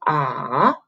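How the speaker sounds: AH dipping down, then up; third tone. Shown as a demonstration. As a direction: draw AH low and bring it back up. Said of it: "you go down, up".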